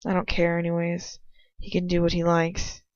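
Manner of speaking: ordinary delivery, nothing marked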